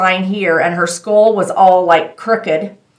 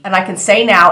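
normal talking voice

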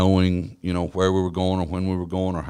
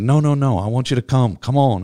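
higher and louder, pressing him to come; retelling an eager customer's insistence; 6 semitones higher, 5 dB louder than usual. Retold or imitated. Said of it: "He said".

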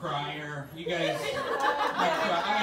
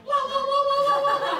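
very high, loud sing-song ray gun; ray gun noise; 9 dB louder, much faster than usual. Retold or imitated. Rapper firing a ray gun, very high and loud. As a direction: warble it like a sci-fi blaster. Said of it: "I want him to be like".

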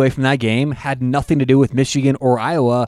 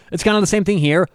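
ordinary delivery

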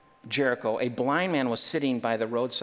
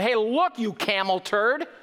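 higher, louder mock scolding; rebuking; 9 semitones higher, 4 dB louder than usual. Crowd rebukes the blind man, a hammed-up high angry shout. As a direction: shut the beggar up, jokingly. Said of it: "rebuked him".

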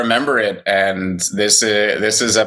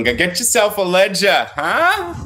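put-on thick New York accent, higher; mock New York tough guy; 9 semitones higher than usual. Teasing his co-host, hammed-up New York accent. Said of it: "He's got his New York accent".

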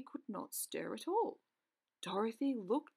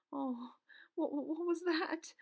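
higher, hesitant question with stammered starts; timid; 6 semitones higher than usual. A narrator's reading. Dorothy asks about a groan nearby, timidly. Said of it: "she asked timidly".

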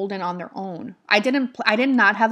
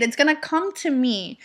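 normal talking voice

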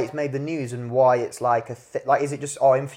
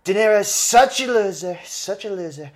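imitating Trump; 5 semitones higher, slower than usual. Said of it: "he's watched Donald Trump go".

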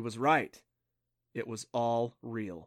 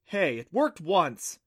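higher, louder, drawn-out protest; defensive; 5 semitones higher, 6 dB louder, slower than usual. Acted. Clancy defends talking to the tablet, defensive protest. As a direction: push back in a defensive huff. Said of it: "she said defensively".

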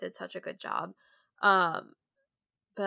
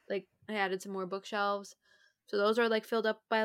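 ordinary delivery

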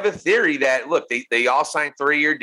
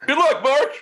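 mock friendly; 11 semitones higher, 3 dB louder than usual. Voicing Cena, scene.

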